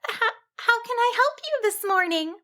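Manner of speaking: high, stammering sheep voice; a timid little sheep stutters out a greeting; nervous wobble. A narrator's reading. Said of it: "managed to nervously wobble out".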